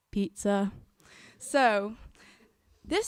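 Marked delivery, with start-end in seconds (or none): none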